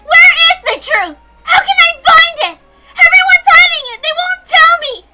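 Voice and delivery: high, loud, crying beg; tearful shouting plea. Acted. Girl begs the hidden voice for the truth, crying and shouting. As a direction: plead through tears at full voice.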